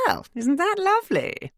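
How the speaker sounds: high, bright-toned polite exclamation; smooth it over with a high posh lilt; tactful posh neighbour. A narrator's reading. Neighbour covering an awkward moment, high and tactful. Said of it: "said Imogen tactfully".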